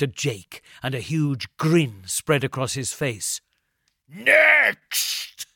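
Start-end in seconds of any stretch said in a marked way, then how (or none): 4.1-5.5 loud, high, gleeful bark. Acted. Brute in the doorway calling Jake in, loud bark. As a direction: bark it like a brutal guard. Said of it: "he barked".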